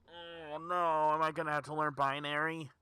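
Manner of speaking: high, loud whine of dismay; real exasperated wail; whiny despair. Real, not acted. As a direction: moan it like the task is doomed. Despair at binary puzzle.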